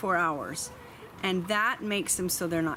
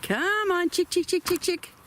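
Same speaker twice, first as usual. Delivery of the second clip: high, louder chick chick call; calling chickens; 9 semitones higher, 3 dB louder than usual. Real, not acted. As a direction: call the flock home. Calling chickens back to coop.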